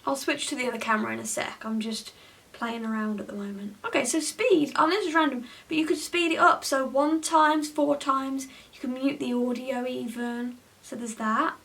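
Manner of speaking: ordinary delivery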